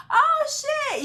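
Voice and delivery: high, delighted yelp; excited exclamation. Real, not acted. Astrologer gushing about a well-dressed Taurus, high squeal.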